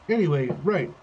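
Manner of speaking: usual speaking voice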